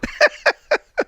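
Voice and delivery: high breathless giggle, way up in pitch; real high-pitched laugh; high squealing laugh. Real, not acted. Laughing at the joke.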